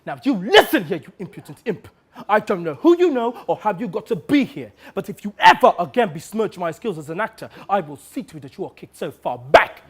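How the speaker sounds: loud, indignant threat building to a shout; haughty, seething dressing-down; furious tirade. Acted. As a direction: tear into an upstart who insulted you. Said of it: "he came flying at me".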